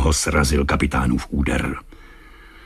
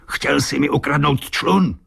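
angry shouting; 9 semitones higher than usual. A narrator's reading. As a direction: accuse him in a raised, angry pitch. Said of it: "křičel kapitán". (rozzlobený křik kapitána)

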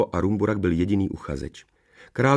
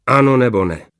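raised, loud demand; angry ultimatum; 4 semitones higher, 8 dB louder than usual. A narrator's reading. Rumburak demanding the king's final answer, angry. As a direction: press hard for an answer.

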